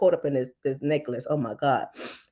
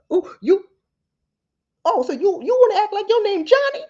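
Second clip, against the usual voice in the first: very high, loud mock challenge; mama taunting a child who acts grown; 5 dB louder than usual. Mother mocking a defiant kid.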